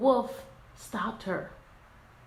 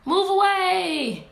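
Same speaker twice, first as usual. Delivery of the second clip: high, loud, slow yell; Red orders the wolf aside with a loud, high shout; angry shout; 9 semitones higher, 7 dB louder, slower than usual. Acted. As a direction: bark it at the wolf in her way. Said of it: "What else?".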